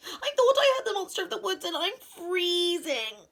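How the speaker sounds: high, rushed, stretched at the end; calling out. A narrator's reading. Mouse begs Owl for shelter from the cold, calling out. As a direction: rattle it off, then wail the last word. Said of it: "called Mouse".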